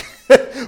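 burst of laughter. Real, not acted. Laughing at panicking servants.

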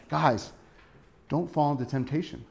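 soft, concerned plea; gentle concern. Acted. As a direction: warn close friends quietly, out of care. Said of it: "I think he's concerned for them".

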